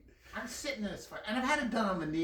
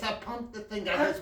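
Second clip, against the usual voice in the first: ordinary talk, nothing marked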